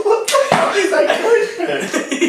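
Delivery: high-pitched, cracking up in disbelief; laughing disbelief. Real, not acted. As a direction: squeal it through real laughter. Cracking up at the interview clip.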